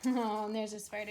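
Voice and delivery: ordinary talk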